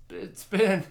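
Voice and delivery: high, loud mock whine; impatient fan plea. Fans' impatient plea to the band.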